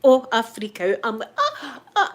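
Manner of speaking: usual speaking voice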